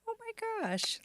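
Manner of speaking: high gushing oh my gosh; delighted gasp. Real, not acted. Cohost gushing over praise, high and delighted. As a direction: swoon at the compliment.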